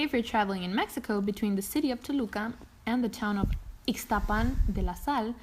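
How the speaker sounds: ordinary talk, nothing marked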